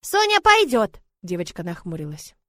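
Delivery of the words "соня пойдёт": put-on high, loud child's voice; insistent little girl. Little Sonya insisting she will come, childish cry. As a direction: pipe up as a determined little girl.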